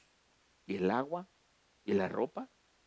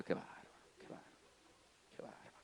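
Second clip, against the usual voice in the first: whispered "qué bárbaro", fast; whispered tsk-tsk; 8 semitones lower, 25 dB quieter, faster than usual. Retold or imitated. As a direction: hiss it like a disapproving sister. Hermana susurra reproche. (susurro de reproche de la hermana)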